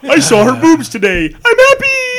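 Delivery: loud, high, gleeful caricature; hammed-up joy rising to a high shout; simple happy husband. Mocking a friend who is easily pleased, loud gleeful caricature.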